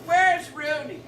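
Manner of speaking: acted booming call-out; burly man's challenge. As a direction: demand it like a big bruiser. Burly man challenges whole bar.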